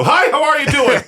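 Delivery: high, over-cheery fast-food greeter; hammed-up chirpy hello with a laugh in it; mock chipper greeting. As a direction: greet like an unbearably perky cashier. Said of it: "get all chipper and cheery".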